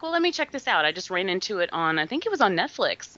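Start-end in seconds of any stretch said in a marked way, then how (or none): 0.0-0.8 higher-pitched self-quote. Retold or imitated. Quoting own thought before watching film.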